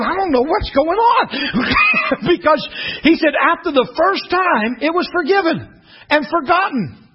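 high-pitched, building sermon climax; high, driving pulpit delivery; impassioned preaching. Real, not acted. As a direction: preach it with rising fervour.

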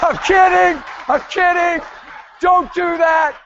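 high, loud comic backpedal; calling out, joking. Real, not acted. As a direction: call it out over the laughter. Calling over laughter after the joke.

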